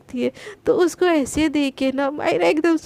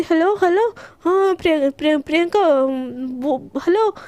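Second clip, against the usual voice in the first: nervous. A narrator's reading. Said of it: "घबराते हुए गरिमा बोलती".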